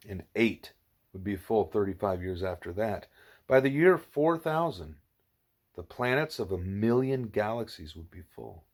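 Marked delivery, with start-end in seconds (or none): none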